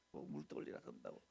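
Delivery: whispered grumble under the breath; mock whispered grumble; mock grumbling. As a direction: mutter the complaint as if not meant to be heard. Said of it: "막 이러고".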